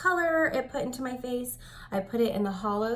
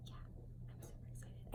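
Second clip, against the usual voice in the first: whispered excitement; 19 dB quieter than usual. Real, not acted. Excited about Target line.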